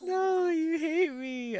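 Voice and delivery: high, loud, drawn-out playful whine; mock wail. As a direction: wail in mock hurt at being hated. Mock hurt at chat's reaction.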